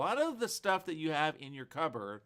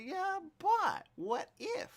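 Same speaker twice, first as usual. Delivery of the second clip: wondering what if; much slower than usual. Retold or imitated. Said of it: "I say".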